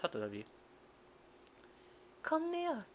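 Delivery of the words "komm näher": put-on high, friendly librarian girl. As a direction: play a bright young girl. Yuko voiced by narrator.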